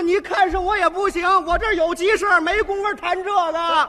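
loud high yell, slowing at the end; shouting back. Retold or imitated. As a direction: shout back while fleeing. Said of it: "我弟弟说".

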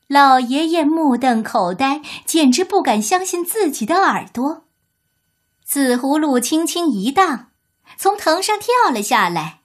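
ordinary delivery

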